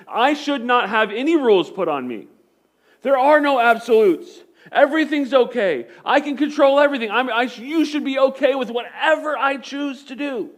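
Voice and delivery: high, loud, arrogant king voice; arrogant Herod. Retold or imitated. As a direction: play Herod sure he controls everything, pushing up loud and high. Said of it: "Herod is sitting there thinking".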